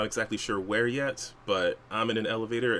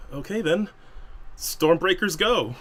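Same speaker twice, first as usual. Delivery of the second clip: raised, higher leader's call; loud, measured battle order; rallying command; 9 semitones higher, 6 dB louder than usual. Acted. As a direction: call the team into action over comms. Team leader rallies over comms.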